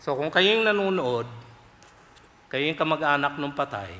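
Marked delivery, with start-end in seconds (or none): none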